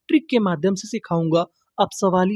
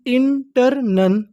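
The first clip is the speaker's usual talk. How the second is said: slow blending; 3 semitones higher, much slower than usual. Shown as a demonstration. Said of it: "तो इतनी meaning क्या बनी".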